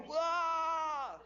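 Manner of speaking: high startled "wah"; hammed-up shriek of fright; mock scared cry. Shown as a demonstration. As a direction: yelp as if jumped at. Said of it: "act as if you're scared".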